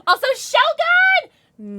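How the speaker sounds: very high, slow, stretched name call; delighted call-out. Real, not acted. Streamer spotting viewer Shogun in chat, delighted high call.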